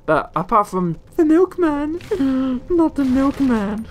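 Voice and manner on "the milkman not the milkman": high, overwrought mock wail; hammed-up high-pitched lament; mock emotional. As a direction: wail in pretend grief for the milkman. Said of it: "I get very emotional about that".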